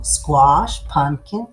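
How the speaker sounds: plain reading voice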